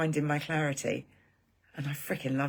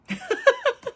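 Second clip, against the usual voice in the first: short high giggle; a quick embarrassed giggle; real high laugh; 6 dB louder than usual. Real, not acted. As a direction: laugh at your own waffling. Laughs at her waffling.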